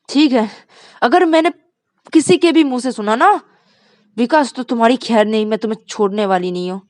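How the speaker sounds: loud, high warning; angry threat. Acted. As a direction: threaten him before leaving. Rani threatens Vikas. (धमकी भरी आवाज़)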